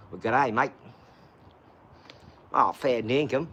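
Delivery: low, broad put-on Australian accent; mock Australian accent. Greeting a mate after the accent remark, low mock Australian accent. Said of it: "that accent is outrageously big".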